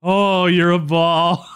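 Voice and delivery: ordinary delivery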